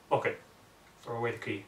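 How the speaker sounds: ordinary delivery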